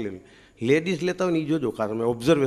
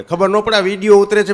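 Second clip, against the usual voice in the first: loud punchline retort; 8 semitones higher, 10 dB louder than usual. Retold or imitated. Comic punchline to wife.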